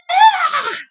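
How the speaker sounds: very loud, high yell of disgust; loud cry. A narrator's reading. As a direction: cry out after a foul swallow. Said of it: "he cried".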